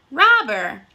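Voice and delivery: normal talking voice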